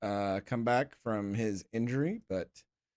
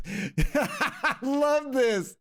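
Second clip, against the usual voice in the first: laughing; 11 semitones higher, 4 dB louder, much slower than usual. Real, not acted. Delighted at the press questions.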